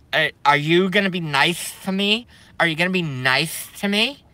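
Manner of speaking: high, pleading mock voice with rising ends; mocking soy boy. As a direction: whine it up high like a timid guy asking for mercy. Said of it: "that look like this and they go".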